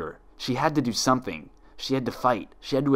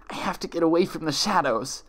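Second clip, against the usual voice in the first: higher, frantic, panicked cry; hysterical panic; 8 semitones higher than usual. Acted. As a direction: blurt it in desperate hysteria. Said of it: "a swell of desperate hysteria".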